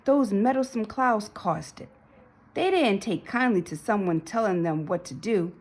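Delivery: ordinary delivery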